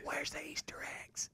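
high voiceless whisper. Pretend. Joking in the grandkids' whispering voice, high and breathless. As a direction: breathe it like a sneaky child.

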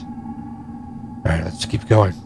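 whispered pep talk; hushed under the breath. Real, not acted. Whispered hype-up.

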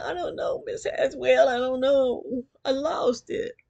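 put-on high, loud panicked child's plea; frightened pleading. Scared child pleading with her teacher, high and loud. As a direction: beg like a scared little girl.

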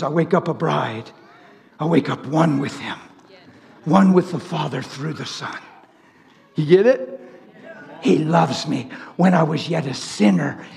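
low, subdued preaching; intimate testimony. Acted. Preacher testifying to waking up His, intimate testimony. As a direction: bring it down low and confiding.

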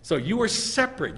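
ordinary talk

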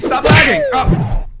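high, loud yell at the laggy screen; frustrated shout. Real, not acted. Gamer yelling at his lagging phone, real frustrated shout. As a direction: bark it at the frozen game.

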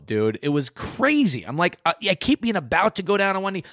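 ordinary talk

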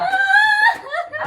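surprised cry. Acted. Uncle reacts to sister crying.

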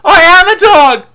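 high, loud wail of horror; horrified realization. Acted. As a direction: shout it in sudden dismay. Sudden horrified realization of transformation.

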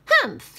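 high, loud scornful humph; witch humph. Acted. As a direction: huff like a witch. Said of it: "said the witch".

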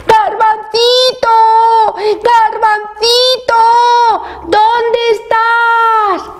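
very high, long-drawn sing-song call; calling out shout. A narrator's reading. Worried parents search the forest for Garbancito, drawn-out shouting. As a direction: call far into the woods, stretching each word. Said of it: "Iban gritando".

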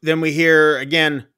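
ordinary delivery

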